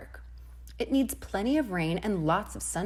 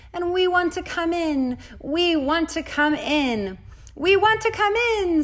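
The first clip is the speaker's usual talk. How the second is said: much higher, louder chant, building; two little voices together; 10 semitones higher, 7 dB louder than usual. A narrator's reading. The sun and rain beg together at the door, high and loud. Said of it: "said their two little voices together".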